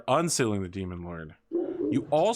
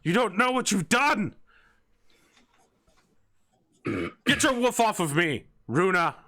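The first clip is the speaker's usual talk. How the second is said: shouting chancellor; 9 semitones higher than usual. Acted. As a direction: rage at the fools. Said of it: "he's like".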